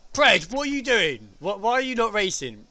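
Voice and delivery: mock scolding call-out. Real, not acted. Calling out a viewer on stream.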